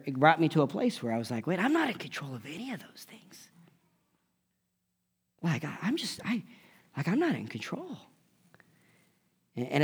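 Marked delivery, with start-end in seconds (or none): 1.5-8.0 breathy hushed whisper, almost to himself; whispered realization. Retold or imitated. Pastor confessing his realization, whispered softly. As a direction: let it dawn on you in a whisper.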